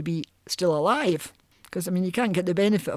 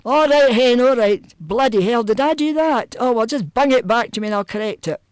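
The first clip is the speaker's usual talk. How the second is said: easy-going Glaswegian lawyer, higher and louder; no-bother Glasgow patter, pitched up and loud; 5 semitones higher, 6 dB louder than usual. Retold or imitated. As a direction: play a breezy Glasgow comedian of a lawyer. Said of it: "phone up a lawyer in Glasgow".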